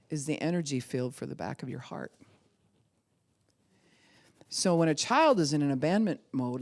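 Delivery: normal talking voice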